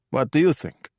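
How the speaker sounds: put-on Italian-accented English; pronounced Italian accent. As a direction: speak like a Calabrian thug trying his English. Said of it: "pitch-perfect Italian accented English".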